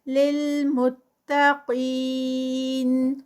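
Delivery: لِّلْمُتَّقِينَ slow, with the final ی stretched four counts; Quran recitation. Shown as a demonstration. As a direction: end لِّلْمُتَّقِينَ with a long four-count madd. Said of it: "four counts کا stretch".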